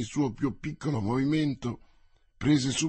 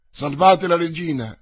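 loud, higher-pitched cry for help; raised voice, calling out urgently; urgent shout; 4 semitones higher, 9 dB louder than usual. A narrator's reading. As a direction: shout it as a desperate plea. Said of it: "gridò Mazzarino al coadiutore".

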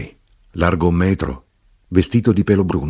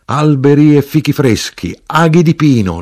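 high, loud toasting chant; resumed shouted litany; 9 semitones higher, 6 dB louder than usual. A narrator's reading. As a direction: proclaim each item exultantly. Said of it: "l'arrotino continuò".

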